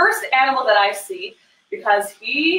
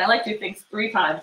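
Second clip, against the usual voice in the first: usual speaking voice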